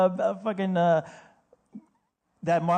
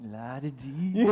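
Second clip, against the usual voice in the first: low, soft, idle sing-song; spaced-out worker; 5 semitones lower, 5 dB quieter, much slower than usual. Acted. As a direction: act a stoned worker, softly in your own little world. Imitating stoned fast food staff.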